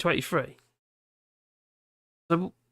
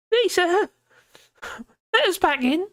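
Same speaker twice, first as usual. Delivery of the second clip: high pleading voice; 3 dB louder than usual. Retold or imitated. Pleading to be let back in.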